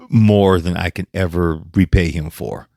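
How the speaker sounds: ordinary delivery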